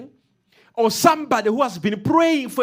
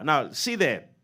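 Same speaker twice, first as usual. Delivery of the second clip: normal talking voice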